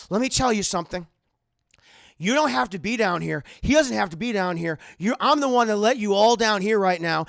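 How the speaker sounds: acted furious demand for respect; domineering, angry Howard.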